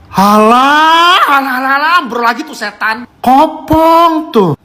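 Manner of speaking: high exasperated groan; fed up with it. Real, not acted. Snacks fall apart.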